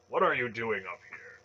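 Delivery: loud, harsh challenge; harsh demand. A narrator's reading. Masked priest challenging intruders, loud harsh demand. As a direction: demand it sharply, loudly. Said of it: "a harsh voice demanded".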